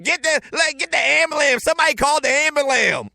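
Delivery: high, loud mocking yell of "ambulam"; mock scream. Kids mocking the coach as ambulances pass, high mocking yell. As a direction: kids taunting, shouting it out. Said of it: "we'd scream out".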